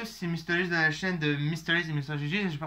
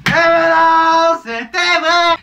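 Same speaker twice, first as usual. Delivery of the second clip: excited shout; 13 semitones higher, 16 dB louder, much slower than usual. Real, not acted. Wrong answer revealed, triumphant.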